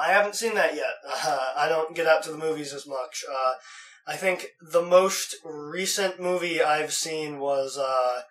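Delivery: ordinary talk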